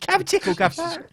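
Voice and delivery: very high mock cockney; squeaky cockney movie urchin. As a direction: put on a silly high cockney voice. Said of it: "everybody talks like a cockney".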